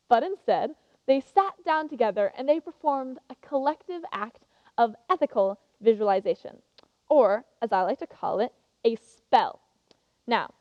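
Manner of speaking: ordinary talk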